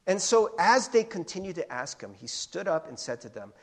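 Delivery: usual speaking voice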